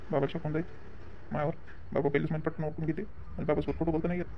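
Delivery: hushed aside to himself; inner thought. Acted. Son thinking to himself in a hushed aside. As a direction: say it under your breath as a private thought.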